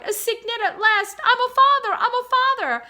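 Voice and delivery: overjoyed father swan crying out his news; joyful shouting. Acted. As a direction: shout it to the whole pond. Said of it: "he cried".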